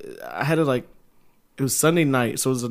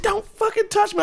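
much higher, snapping threat from the sister; shrill sister threat. Retold or imitated. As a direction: play the sister lashing out, pitched way up.

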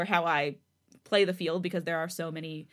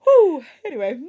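ordinary delivery, nothing marked

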